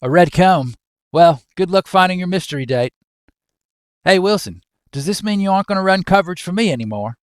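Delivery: lightly faked Australian accent, teasing a mate; attempted Australian. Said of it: "I can't do Australian".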